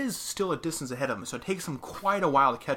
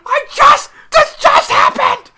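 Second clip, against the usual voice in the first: high, loud, desperate English pleading shout; begging for his life; 16 dB louder, slower than usual. Retold or imitated. English player begging the hunting crew to spare him, shrieking high. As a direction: scream for mercy like you're about to be shot. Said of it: "He's like".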